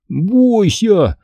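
mocking imitation, pitched high; hammed-up spooky warning; Амг. A narrator's reading. Boy mimicking the man for friends, mocking. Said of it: "очень похоже, передразнил мистера Амга".

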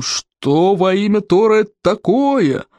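slow menacing bellow; terrible voice. A narrator's reading. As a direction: demand it menacingly. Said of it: "страшным голосом".

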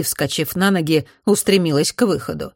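plain reading voice

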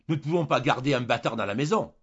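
angry cry. A narrator's reading. Julien loses his temper at his wife, angry cry. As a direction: cry out as temper takes over. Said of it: "il cria, comme s'il s'emportait".

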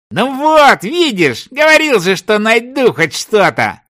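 high, excited vindication; triumphant I-told-you-so. A narrator's reading. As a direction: crow over your sceptical partner. Searcher triumphant at being right. (торжествующе, на высокой ноте)

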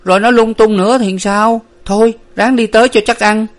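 put-on high, loud anxious boy's voice; worried boy. A narrator's reading. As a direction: fret aloud, urging the others on. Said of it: "Đến lượt thằng Tâm lo sợ".

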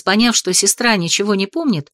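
ordinary delivery

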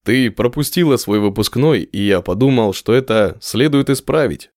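ordinary delivery

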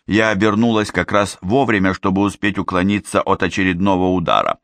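ordinary delivery

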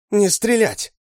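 high, loud urgent command; woman screaming. A narrator's reading. Woman orders soldiers to hold fire, urgent scream. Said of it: "закричала женщина солдатам".

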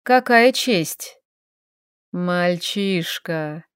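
slow, velvety sneer; seductive demon purr. A narrator's reading. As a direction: drawl it like a predator. Dragon mocks the elf.

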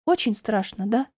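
tentative, gentle question; understated wary curiosity; cautiously curious. A narrator's reading. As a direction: probe carefully. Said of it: "осторожно поинтересовалась Чарли".

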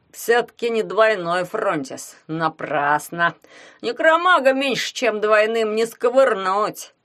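slow, grudging, teeth clenched; through gritted teeth. A narrator's reading. Glomov comments on the weak spark, through gritted teeth. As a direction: squeeze the words out with disapproval. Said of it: "процедил Гломов".

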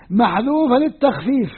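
ordinary delivery

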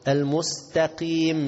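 slow "المستقيم" with light t; correct light ta. Shown as a demonstration. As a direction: stretch "المستقيم", keeping ت thin. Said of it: "خلي الت طا". («المستقيم» بتاء مرققة)

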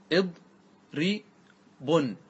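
اضربن split as "id... ri... bun", very slow; اضربن very slowly, pausing after each syllable. Shown as a demonstration. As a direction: spell out اضربن in three separated syllables. Teacher breaks word into syllables.

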